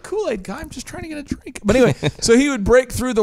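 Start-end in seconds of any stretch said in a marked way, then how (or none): 0.0-1.6 high, much softer, put-upon complaint; annoyed kid. Retold or imitated. Startled drinker complaining to the Kool-Aid Man, meek whine. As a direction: plead weakly to be left alone. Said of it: "And you're like".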